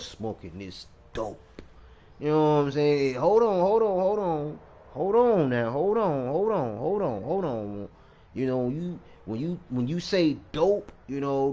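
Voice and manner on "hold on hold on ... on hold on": mock interruption. Halts himself to clarify dope.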